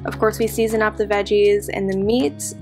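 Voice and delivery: ordinary talk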